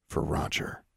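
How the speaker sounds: soft unvoiced whisper; whispered resolve. A narrator's reading. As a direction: breathe it with resolve. Quiet resolve, chapter end.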